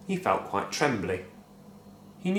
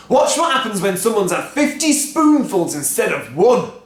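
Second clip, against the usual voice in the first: excited call; 9 semitones higher, 8 dB louder than usual. A narrator's reading. Father excitedly calls out.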